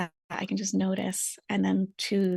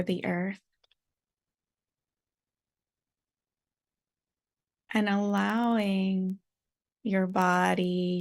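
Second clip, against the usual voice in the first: calm meditation guide with long silences; guided meditation. Real, not acted. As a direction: settle listeners gently, phrase by phrase.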